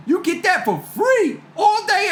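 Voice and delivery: excited pitch. Real, not acted. Selling himself to Pluto.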